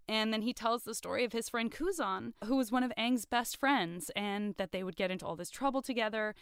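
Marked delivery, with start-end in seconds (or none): none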